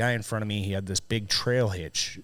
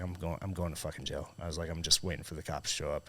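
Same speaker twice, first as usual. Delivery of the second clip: low, soft resigned retelling; retold freaked-out; 5 semitones lower, 9 dB quieter than usual. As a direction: say it low, as if doomed. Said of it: "I was freaked out".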